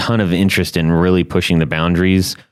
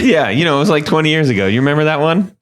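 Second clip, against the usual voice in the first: mock echo; 8 semitones higher than usual. Host mockingly echoes friend's vague answer.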